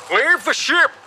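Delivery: high, urgent captain's shout; shouted order. A narrator's reading. Captain to everyone on the sinking ship, shouting. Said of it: "the captain shouted to everyone".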